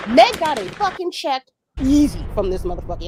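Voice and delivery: ordinary talk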